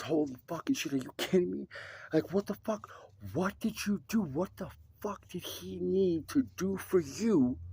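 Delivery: high-pitched, outraged disbelief; adamantly upset. Retold or imitated. Son confronting the man his father rescued, high upset disbelief. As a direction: imitate a furious son demanding answers, pitched well up. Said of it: "they were so adamantly upset".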